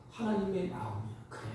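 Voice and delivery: ordinary delivery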